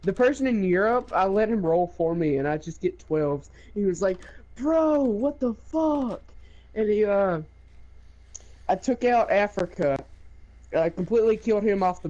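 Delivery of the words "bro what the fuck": high, drawn-out mock exasperation; imitated incredulous wail, slow and high; exasperated disbelief. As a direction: stretch it out like a stunned sore loser. Retold opponent reacting to endless twelves.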